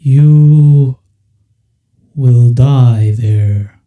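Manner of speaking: slow, loud and foreboding; ominous whale voice. Acted. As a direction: warn them slowly like an ancient beast. Whale warns the party.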